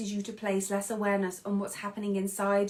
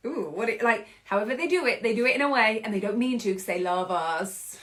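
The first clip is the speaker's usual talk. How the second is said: ordinary delivery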